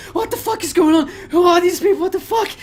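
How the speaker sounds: high, loud panicked yelling; a terrified scientist screaming in horror; horrified scream. Acted. Scientist screaming at the shark people in horror. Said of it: "screams in horror and in pain".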